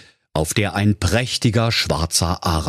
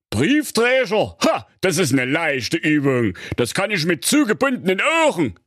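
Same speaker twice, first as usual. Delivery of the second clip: proud wizard; 9 semitones higher than usual. Acted. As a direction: puff up with vanity. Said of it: "Der Zauberer sagte stolz". (stolzer Zauberer)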